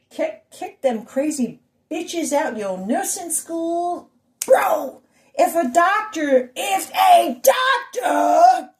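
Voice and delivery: high, slow drawn-out preaching, building to a shout. Acted. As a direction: stretch the words like a fired-up preacher.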